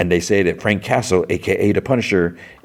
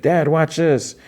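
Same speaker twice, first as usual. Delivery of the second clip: put-on much higher kid's call; imitated young son; 10 semitones higher, slower than usual. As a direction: call out to Dad like a child. Son calling to dad at pool.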